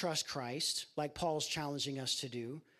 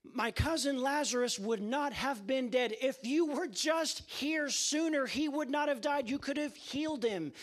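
mild grieving plea; 7 semitones higher than usual. Acted. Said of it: "weeping and suffering and saying".

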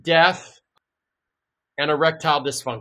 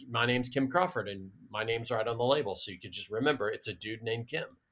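quiet aside; 5 semitones lower, 10 dB quieter than usual. Retold or imitated. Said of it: "And I'm".

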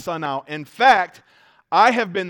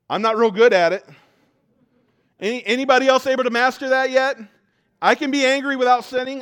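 playful confession; 7 semitones higher, 7 dB louder than usual. Real, not acted. Joking confession to congregation.

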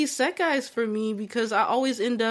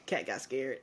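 much lower and softer, laughing; husband's teasing mumble; 8 semitones lower, 11 dB quieter than usual. Retold or imitated. Husband teasing her after she farts, low and soft. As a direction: hush it low as a tease. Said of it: "He's like".

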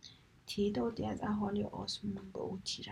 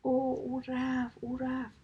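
drawn-out, louder and higher calling out; crowd crying out; 4 semitones higher, 5 dB louder, slower than usual. A narrator's reading. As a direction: call it out slowly, stretching each word. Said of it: "فریاد کردن". (فریاد کشیده و بلند)

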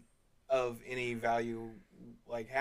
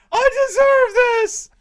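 mock scream; 17 dB louder, slower than usual. Quoting movie character's scream.

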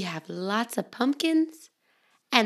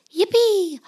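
high, louder yippee; cheer; 12 semitones higher, 4 dB louder than usual. Acted. Little pumpkin cheering for fall, high and loud. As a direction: cheer it out gleefully.

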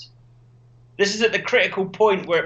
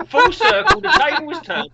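mock outrage; 13 semitones higher, 6 dB louder than usual. Mock-outraged bigot rant.

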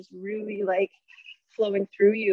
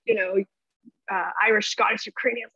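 usual speaking voice

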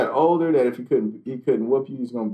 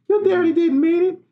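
put-on much higher, soothing old-lady voice; imitating grandma; 3 dB louder than usual. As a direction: coo it like a grandma comforting a child. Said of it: "it was Grandma".

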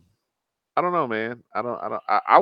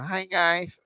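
quoting Samantha's greeting; 7 semitones higher, 7 dB quieter than usual. Retold or imitated. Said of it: "That goddamn".

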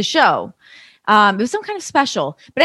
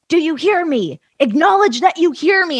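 higher-pitched mock yell; retold yelling; 8 semitones higher than usual. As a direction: imitate an angry boss demanding an answer. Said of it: "So he yells at me again".

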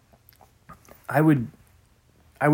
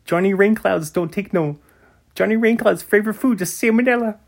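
silly character voice; 8 semitones higher than usual. Pretend. Hamming up Johnny Rainclouds bit.

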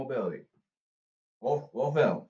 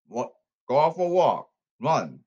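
ordinary delivery

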